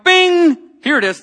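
high loud bing, then here it is; excited bing. Retold or imitated. Preacher cheerfully offering his card to the cashier, excited. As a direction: announce it like a game-show chime.

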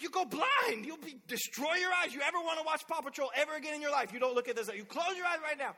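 loud, high, over-the-top warning; dad lecturing his daughter in the back seat; exaggerated parent lecture. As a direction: scare the kid into obeying.

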